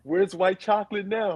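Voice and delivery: put-on a light Boston accent, coach taunting; mock Boston accent. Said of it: "How he got a Boston accent".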